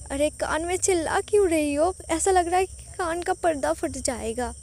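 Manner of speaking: husband grumbling loudly, half teasing. A narrator's reading. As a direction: protest that the shout hurt his ear. Husband complains about her shouting.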